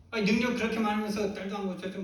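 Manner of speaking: high, loud mock-indignant challenge; acted quarrelling. Preacher as unbelieving mother confronting Jesus, acted quarrelling. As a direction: pick a fight, pitch jumping up. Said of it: "막 싸우쳐야죠".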